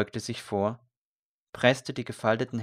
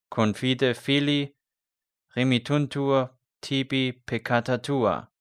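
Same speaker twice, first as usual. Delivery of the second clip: solemn Latin; 3 semitones higher, 4 dB louder than usual. A narrator's reading. Priest speaks Latin absolution.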